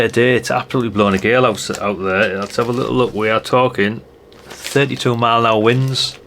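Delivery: normal talking voice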